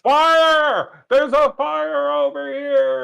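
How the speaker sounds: loud, high-pitched alarm shout; yelling fire. Acted. Player fakes a fire alarm for the townsfolk, yelling. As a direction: raise the alarm at full voice, drawn out. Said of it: "We start yelling".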